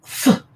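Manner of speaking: f as a bare hissed sound, no vowel; just the breathy "f" sound, not the letter's name; isolated voiceless f; sounded-out consonant. Shown as a demonstration. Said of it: "The G H is pronounced".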